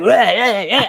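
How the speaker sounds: mimicked martial-arts yells; mock action-hero attack noises; Power Rangers battle cries. Mimicking son at the mirror, mock battle cries. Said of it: "doing Power Rangers moves".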